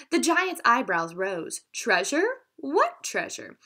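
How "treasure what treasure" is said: eager surprise. A narrator's reading. Giant suddenly interested.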